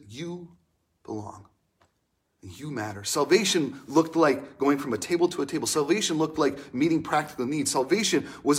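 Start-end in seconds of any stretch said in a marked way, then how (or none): 0.1-3.0 tender. Acted. Jesus speaks to the kids.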